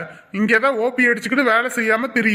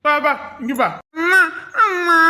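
put-on high little-boy call; child calling mum; 4 semitones higher than usual. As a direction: cry out after her like a toddler. Child calls leaving mother.

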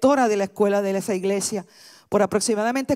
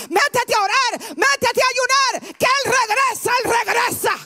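much higher, shouted sermon climax; full-force preaching; 11 semitones higher than usual. Acted. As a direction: preach it out to the whole room, full throttle. (predicación a todo pulmón, gritando)

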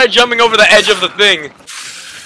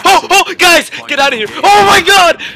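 being chased; 10 semitones higher than usual. Real, not acted. Zombies attacking, panicked shouts.

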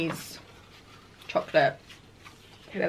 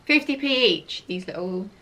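usual speaking voice

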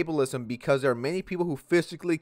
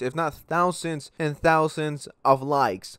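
usual speaking voice

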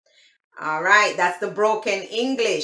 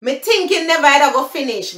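loud, fast Jamaican patois; 5 semitones higher, 4 dB louder, faster than usual. Shown as a demonstration. Showing viewers the patois version, in Jamaican patois. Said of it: "That's the broken English".

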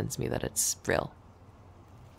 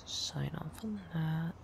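whispering; 3 semitones higher, 11 dB quieter than usual. Real, not acted. Quietly working at her desk.

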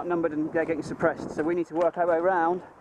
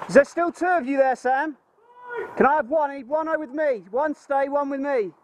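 higher, louder call to a teammate; 9 semitones higher, 3 dB louder than usual. Real, not acted. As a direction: shout across the gap. Calling to teammate across position.

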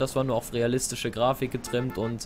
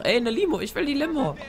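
excited; 12 semitones higher than usual. Real, not acted. Spots a limo in game.

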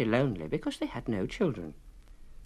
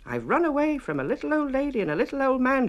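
high, louder, boastful little shout; high loud bragging cookie; 13 semitones higher, 4 dB louder than usual. A narrator's reading. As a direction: brag over his shoulder. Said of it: "shouted the gingerbread boy".

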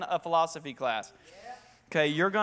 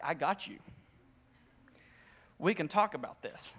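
soft, lower under-breath agreement; confident aside; 4 semitones lower, 8 dB quieter than usual. Retold or imitated. Student answering the professor in his head, murmured. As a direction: murmur it like a private thought. Retold inner reply to professor.

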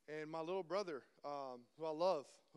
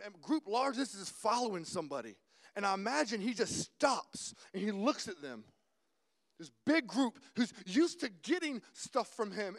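normal talking voice

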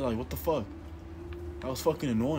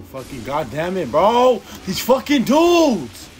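building to a high, loud furious yell, slow at the end; real rage yell; 7 semitones higher, 11 dB louder, slower than usual. Real, not acted. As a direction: explode at the dudes. Raging at enemies chasing him.

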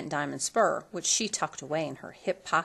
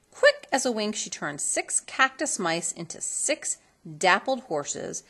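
ordinary delivery

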